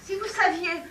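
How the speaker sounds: loud, high furious outburst. Acted. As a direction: blaze at him. Acted lovers' quarrel, she rages. (éclat de colère, aigu et fort)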